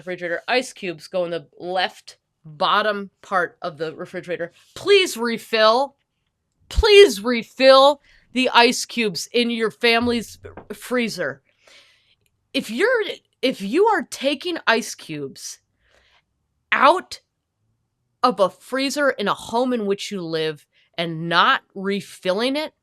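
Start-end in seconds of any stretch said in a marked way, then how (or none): 4.7-11.4 loud, slow, insistent pleading; stern public plea, slowed right down; angry plea. Real, not acted. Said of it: "If I seem angry, it is, I am". 12.5-22.7 exasperated lecture on refilling; angry rant. Real, not acted. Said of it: "If I seem angry, it is, I am".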